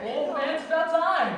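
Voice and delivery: high, loud and elated; rowdy congratulations. Acted. Friend congratulates the groom at last, rowdy. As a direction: cheer your buddy on loudly.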